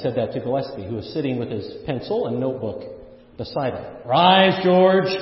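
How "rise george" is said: louder call to stand and speak; mock rallying cry; loud urging. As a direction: call him up with force. Same plea retold, said loudly.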